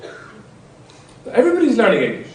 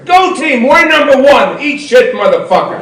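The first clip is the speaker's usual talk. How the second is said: higher, hammed-up sideline yell; mock American sports parent; 7 semitones higher, 4 dB louder than usual. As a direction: bellow like a pushy parent at a kids' game. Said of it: "shouting at their children".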